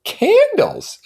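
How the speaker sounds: high, loud objecting Daisy voice; a frowning young woman's protest; high-pitched petulant objection. A narrator's reading. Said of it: "objected Daisy, frowning".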